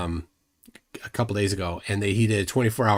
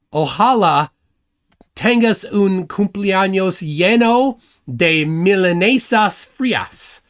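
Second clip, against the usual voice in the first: slow, careful, high Spanish read with an English accent; bad Spanish accent; 6 semitones higher, 5 dB louder, slower than usual. Shown as a demonstration. Host reads his clumsy Spanish birthday wish from the teleprompter, slow and careful. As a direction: read the Spanish birthday line haltingly, like a beginner. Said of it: "That's how bad I am at Spanish".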